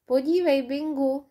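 ordinary delivery, nothing marked

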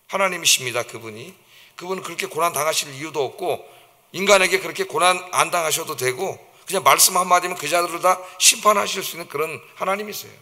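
ordinary talk

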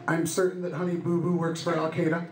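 usual speaking voice

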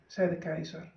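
usual speaking voice

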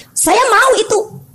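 slow, high, yearning declaration; seruan penuh tekad. Real, not acted. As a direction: draw it out high, wanting it badly. Preacher declares longing with force. (berseru tegas, lambat dan tinggi)

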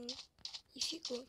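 ordinary talk

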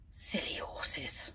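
soft, slow whisper; fond whisper. Real, not acted. Watching stubborn ponies, she whispers fondly. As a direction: breathe it out fondly.

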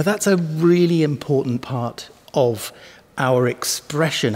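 usual speaking voice

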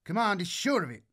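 high, eager radio report; excited young voice over the radio. Acted. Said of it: "Grishin's excited tone".

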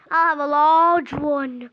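loud, slow put-on customer voice; second customer. Male customer choosing a size for the seller, loud put-on voice. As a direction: boom it out slowly as the pretend guy. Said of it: "now he came in".

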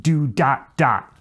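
"do" held long, each "dot" cut short. Shown as a demonstration. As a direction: scat "do dot dot" as jazz articulation. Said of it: "long, short, short".

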